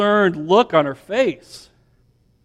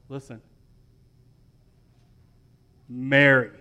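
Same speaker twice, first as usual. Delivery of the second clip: hushed tender call; 8 semitones lower, 7 dB quieter than usual. Acted. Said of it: "And Jesus said to her".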